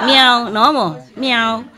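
imitated meow, loud, with a laugh in it; cat meow. Shown as a demonstration. Man coaxing a stray cat, meowing with a laugh. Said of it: "It go like this".

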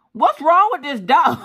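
high, loud outburst of disbelief; exasperated bafflement. Retold or imitated. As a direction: cry out, baffled by a stubborn dog. Baffled that dog won't move.

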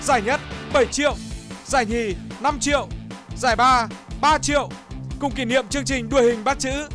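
ordinary talk

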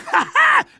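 a quick high burst of laughter; short triumphant laugh. Acted. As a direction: laugh in delight at the hit. Cheering Freeman's strider kill.

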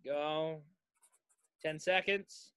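ordinary talk, nothing marked

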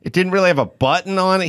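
ordinary delivery

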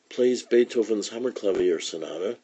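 usual speaking voice

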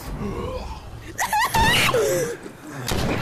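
a high, loud shrieking gasp; sudden terrified shriek; screaming gasp. Real, not acted. Sudden scare.

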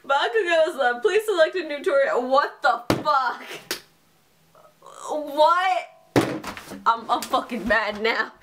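normal talking voice

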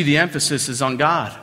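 ordinary talk

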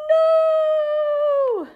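high loud scream of no; terrified cry. Acted. Seed character screams at the threat, terrified. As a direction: shriek it in horror.

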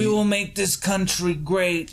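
high-pitched mock politician impression, breaking into laughter; Trump. Asked to try an impression, mock Trump voice. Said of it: "why don't you try your Trump impression".

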